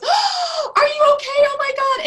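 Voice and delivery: high, loud panicked shriek; catastrophizing mother. Acted. Mother rushing to a fallen toddler, panicked overreaction. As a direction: gasp it out in mock horror, high and loud. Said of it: "and catastrophizes it".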